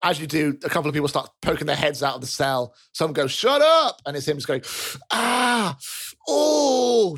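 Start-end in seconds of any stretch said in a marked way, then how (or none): 3.3-4.0 high, loud annoyed yell; yelled from cells. Acted. 5.1-7.2 high, hammed-up moans of pain; comic injured inmate wailing; groans of pain. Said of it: "Just, just in so much pain".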